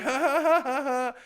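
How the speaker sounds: high, loud fake laughter; mock hearty laugh covering shame; put-on laugh. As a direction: laugh it off in high, forced ha-has. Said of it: "But I'm like".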